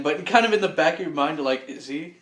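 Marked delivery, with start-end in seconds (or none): 1.7-2.2 very soft, hushed question; small, quiet aside to yourself; private doubt. Acted. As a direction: murmur it as a doubtful thought. Doubt at back of mind.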